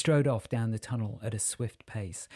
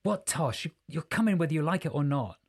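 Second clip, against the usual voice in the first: higher and fast, scolding; exasperated; 6 semitones higher, faster than usual. Acted. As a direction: snap at him impatiently. Jessica scolds Archie.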